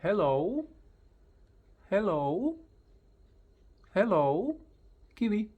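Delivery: high, loud rising hellos; loud, lifted hello call; excited hello. Shown as a demonstration. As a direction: bounce it at the bird. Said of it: "I just come closer and I say".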